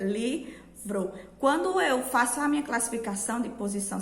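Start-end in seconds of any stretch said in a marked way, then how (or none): 0.0-1.1 "Livro" pulled apart into Li... vro; sílabas devagar. Shown as a demonstration. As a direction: pronounce "Livro" slowly, one syllable at a time. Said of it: "falando todas as suas sílabas". ("Livro" devagar, sílaba por sílaba)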